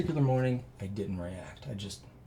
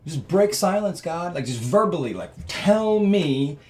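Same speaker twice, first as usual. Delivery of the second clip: high, loud demand; shouting up at God for a word; yelling at God; 6 semitones higher, 5 dB louder than usual. Retold or imitated. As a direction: plead loudly for an answer. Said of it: "Like, I'm, like, yelling".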